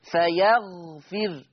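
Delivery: slow "فيغفر" stopping on a bare r; still raa. Shown as a demonstration. Teacher demonstrating Warsh, slow "فيغفر" with final r vowelless. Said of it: "يسكن الراء".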